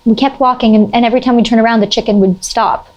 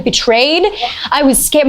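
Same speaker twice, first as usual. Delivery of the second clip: ordinary talk, nothing marked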